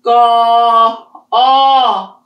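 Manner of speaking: put-on teacher pushing the tone for kids; exaggerated tone drill. Shown as a demonstration. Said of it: "What about go".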